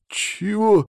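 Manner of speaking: high, startled question; gasped surprise. A narrator's reading. Gorodetsky hears about the artifact, gasping in surprise. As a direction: gasp the word in disbelief. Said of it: "ахнул Городецкий".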